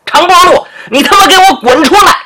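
high, loud, fast furious shout; angry yell. A narrator's reading. Angry boss yelling from the back yard, furious. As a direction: roar at him to get out. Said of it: "可没那么客气".

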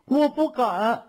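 high, slow, faltering little voice; timid. A narrator's reading. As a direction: stammer it slowly, scared and high. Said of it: "又怯生生地说". (怯生生地，又高又慢)

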